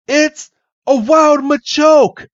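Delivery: high, drawn-out cry of alarm; alarmed shout. Acted. Characters warning each other about the wild Machoke, alarmed high shout. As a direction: yell the warning as the monster towers over them. Characters spot attacking monster.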